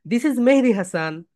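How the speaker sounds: slow, with a swell on "Mehedi"; presentation self-introduction. Shown as a demonstration. Teacher models introducing himself to an audience, stressing "Mehedi". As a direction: stretch "Mehedi" as the key word. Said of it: "আমি আমার নাম বলবো".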